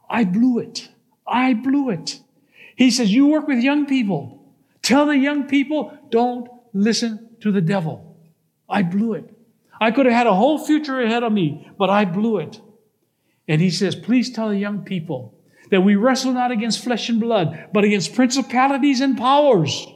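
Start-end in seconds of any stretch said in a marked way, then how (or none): 0.1-12.6 raised-pitch, emphatic lament; regretful plea. Acted. Jailed man to his pastor, urgent regretful plea. 14.0-19.9 higher, emphatic preaching appeal; urgent plea. Acted. Prisoner's message to young people, urgent high plea.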